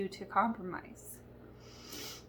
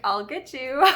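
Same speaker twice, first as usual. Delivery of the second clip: playful threat; 3 semitones higher, 9 dB louder than usual. Pretend. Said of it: "God was like".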